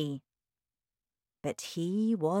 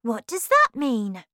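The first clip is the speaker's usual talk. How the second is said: raised, louder questioning voice; puzzled child; 5 semitones higher, 7 dB louder than usual. A narrator's reading. Charlie asking Wonka about the label, puzzled. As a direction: ask in curious bewilderment.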